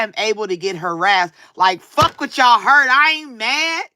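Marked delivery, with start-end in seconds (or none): none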